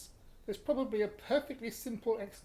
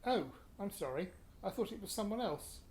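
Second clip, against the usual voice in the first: ordinary talk, nothing marked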